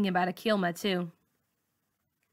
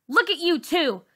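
louder, higher mocking sneer; 8 semitones higher, 5 dB louder than usual. Bully sneering at the girl and teacher as she leaves, loud sneer. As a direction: scorn them on the way out. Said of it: "she says".

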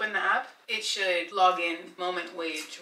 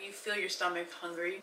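ordinary delivery

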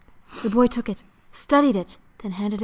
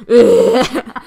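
disgusted grunt; 12 dB louder than usual. Retold or imitated. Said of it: "you just hear".

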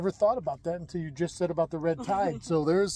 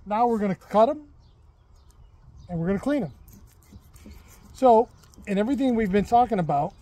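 louder on-camera presenter voice; cheerful cooking-show host, louder than the chat; upbeat host to camera; 6 semitones higher, 11 dB louder than usual. Acted.

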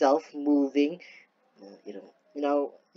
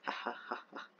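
ordinary talk, nothing marked

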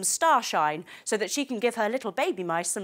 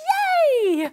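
cheer; 7 dB louder than usual. Acted. Cheering the unicorn.

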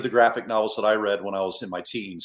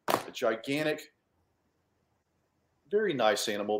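ordinary talk

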